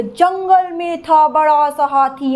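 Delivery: slow rhythmic story voice, stretching बड़ा; storytelling teacher. Shown as a demonstration. Teacher models a children's elephant story, slow and sing-song. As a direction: tell it to small children with drawn-out, stressed words. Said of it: "जब मान लो कोई story बता रही है".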